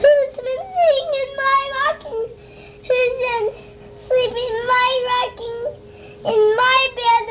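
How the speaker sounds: high, fast squeaky cub voice; baby bear squealing about his bed; loud Baby Bear. Acted. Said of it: "Make his voice as loud as he can".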